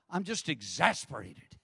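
usual speaking voice

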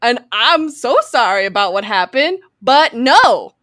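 mock Southern apology with a firm finish; hammed-up Southern impression; Jenna Marbles. Podcaster continues Michael's brush-off, mock Southern accent. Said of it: "Why is Michael suddenly Southern?".

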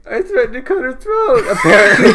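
loud, high wailed confession; hammed-up sobbing kid, breaking into laughter; mock bawling. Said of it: "I'm bawling my eyes out".

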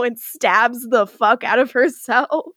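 normal talking voice

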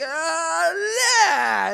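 mock tantrum cry of a little boy; toddler tantrum scream. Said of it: "screaming like".

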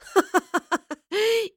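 high, loud mocking cackle; scornful villain's laugh; derisive laugh. A narrator's reading. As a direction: laugh right in their faces. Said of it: "Lorelei laughed derisively".

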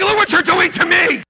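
high, loud mock accusation; mad shouting. Dad blaming son for his seizure, mock angry shout. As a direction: yell it as the wronged one, hammed up. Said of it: "I just get mad".

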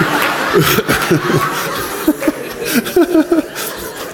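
high breathy laughter; real amused laugh. Real, not acted. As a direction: burst out laughing at the joke. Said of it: "[name] laughing".

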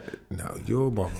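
ordinary delivery

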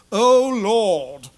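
mock a pompous public prayer; mocking Pharisee prayer. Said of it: "the prayers of the Pharisees".

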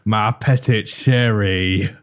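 slow, drawn-out mock French accent; hammy French Gambit impression. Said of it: "My French accent's so bon".

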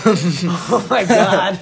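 laughing. Real, not acted. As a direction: let the laugh stretch the words. Laughing about almost burning backyard.

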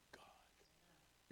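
hushed reverent whisper; a whispered God, almost no sound. Real, not acted. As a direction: breathe the word God as a whisper. Reverent hush on the key word.

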